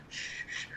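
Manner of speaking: put-on very soft, voiceless baby shushing; soothing shush. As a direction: hush a cradled baby. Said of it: "like, cradling it".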